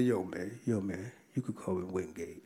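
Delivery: low, hushed imitation of an inmate; mock whisper. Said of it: "He kinda whispered and said".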